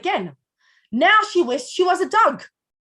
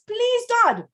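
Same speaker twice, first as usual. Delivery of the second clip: higher-pitched acted plea to God; pleading prayer; 7 semitones higher than usual. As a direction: beg earnestly, pitch lifted throughout. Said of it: "turns to God and says".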